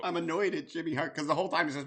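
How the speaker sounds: ordinary talk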